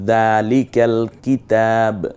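slow recited যালিকাল কিতাব, stopping on the b; যালিকাল কিতাব recited slowly; Quran recitation. Shown as a demonstration. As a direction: recite it slowly, halting on the last letter. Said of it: "শেষাক্ষরে থামি".

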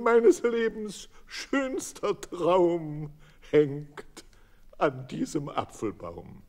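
high, loud and drawn-out wailing; tearful lament. Acted. Witwe Bolte wailing over her hanged hens, loud and slow. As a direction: cry it out like a grieving widow.

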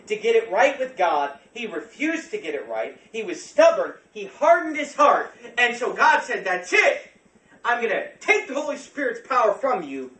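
loud, high, forceful pulpit delivery; impassioned preaching. Real, not acted. Preacher telling of God judging stubborn Saul, impassioned loud preaching.

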